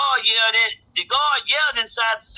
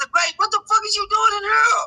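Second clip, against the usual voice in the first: rising shocked yell; 11 semitones higher than usual. Retold or imitated. Builds the shocked question higher.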